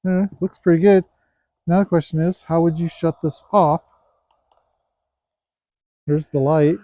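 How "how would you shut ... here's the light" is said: ordinary talk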